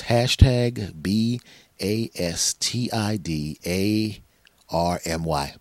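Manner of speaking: slow, letter by letter; spelled out. Shown as a demonstration. For listeners who email, spelling slowly. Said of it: "asking me how to spell it".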